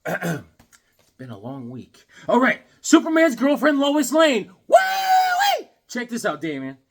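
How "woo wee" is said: high shouted woo-wee; thrilled collector whooping over a comic; excited whoop. Real, not acted. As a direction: whoop with joy at the find.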